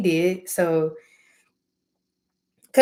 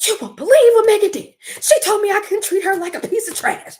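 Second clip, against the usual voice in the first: high, loud mocking gossip voice; mocking relatives; 13 semitones higher, 6 dB louder than usual. Mocking family tattling on her, high and loud. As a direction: squeal it like a gossiping aunt. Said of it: "that's how they act".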